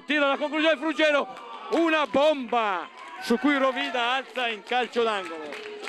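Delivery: high, urgent rapid-fire calls; breathless italian goal commentary. Real, not acted. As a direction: call the attack like a near-goal. Attack and shot called live.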